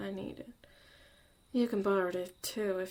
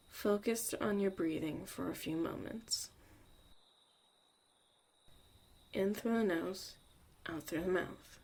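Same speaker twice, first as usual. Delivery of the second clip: guided meditation. Acted. Leads a breathing meditation.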